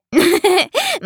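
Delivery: put-on high, childlike giggle; bursting into laughter. A narrator's reading. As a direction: burst out giggling as the little prince. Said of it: "quando scoppiò pure a ridere". (risatina acuta da bambino)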